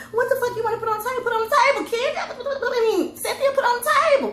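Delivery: high, fast mocking call to the table; mock bossy hostess. Host mockingly ordering guests to the table, high and fast. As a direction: lampoon a pushy host rounding up guests.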